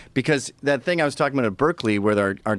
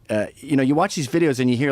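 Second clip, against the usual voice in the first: ordinary talk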